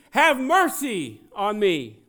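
high, slow, full-voiced cry; shouted plea. Blind man begging Jesus for mercy, shouting slowly. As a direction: yell the plea, drawing it out. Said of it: "he began to shout".

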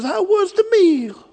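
high, drawn-out Italian waiter voice; playful impression of an Italian headwaiter; Vincenzo. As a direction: ask it slowly with a hammed-up Italian accent. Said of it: "Vincenzo says".

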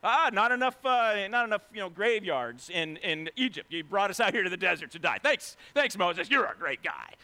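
complaining Israelites mocking Moses, loud and sarcastic; ticked-off crowd. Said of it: "They're all, like, ticked off".

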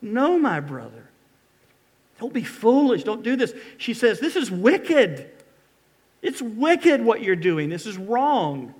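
high, loud, desperate pleading; pleading cry. Acted. Tamar crying out to her brother, loud and pleading. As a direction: beg him to stop, voice straining. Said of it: "she cries out to him".

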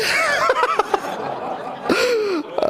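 long high-pitched laugh; real helpless laughter; laughing fit. Real, not acted. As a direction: burst out laughing and let it run. Laughs at his own slip.

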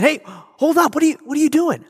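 high, alarmed outcry; startled protest. Retold or imitated. Student catching a thief with his stereo, high alarmed protest. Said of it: "And the student said".

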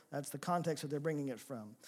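ordinary delivery

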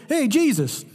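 high, bright call to break in; interrupting Peter. Acted. Peter interrupting Jesus, Moses and Elijah, high eager call. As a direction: butt in cheerfully. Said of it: "He interrupts them".

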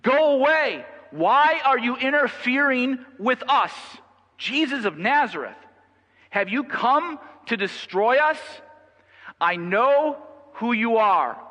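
high, loud, drawn-out cry of an evil spirit; full-voiced, high-pitched demonic outcry; demon's shout. A narrator's reading. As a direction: shout it like a possessed man, stretching the words. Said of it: "cried out, shouting".

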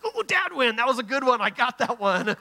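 high, loud mock celebration; playful triumph. Dad congratulating himself on a parenting win, playful triumph. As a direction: crow over a little victory, starting very high.

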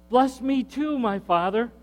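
high pleading plea; mild mock wail; bitter cry. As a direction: beg your father with a break of grief. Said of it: "with a loud and bitter cry".

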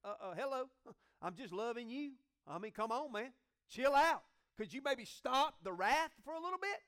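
a hint of a frustrated prisoner; exasperated complaint to God. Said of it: "You would have the right to go".

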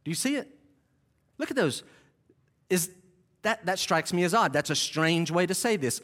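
ordinary talk, nothing marked

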